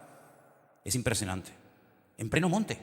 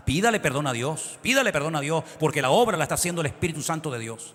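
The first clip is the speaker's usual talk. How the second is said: louder urging preacher's voice; emphatic sermon close; rising preaching; 5 semitones higher, 6 dB louder than usual. Acted. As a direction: build back to a firm warning. Preaching at full force again.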